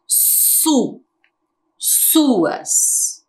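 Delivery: "Su. Suas." with a long hissed s at the start; initial S sound. Shown as a demonstration. Teacher shows how initial S sounds, stretched hissing s in "Su. Suas.". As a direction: draw out the opening s of "Su", then "Suas". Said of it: "Como que eu falo o S no começo?".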